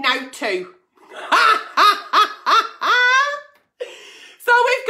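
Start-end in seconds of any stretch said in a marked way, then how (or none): 1.2-3.4 high burst of laughter. Real, not acted. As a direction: cackle at the letter. Laughing at the letter.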